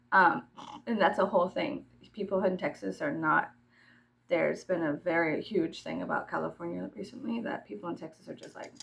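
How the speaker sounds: usual speaking voice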